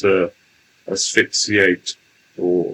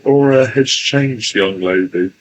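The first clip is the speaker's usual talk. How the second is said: slow channeled entity voice; 4 semitones higher, 6 dB louder, slower than usual. Acted. Channeled entity addresses the young lady.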